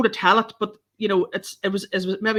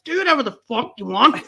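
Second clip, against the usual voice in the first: raised-pitch exasperated retort; mock exasperation; 6 semitones higher, 3 dB louder than usual. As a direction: act out losing patience with fussy subjects. Acted exasperated reply to subjects.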